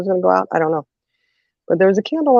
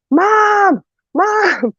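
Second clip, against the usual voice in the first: calling for mom. Retold or imitated. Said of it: "And I'm going".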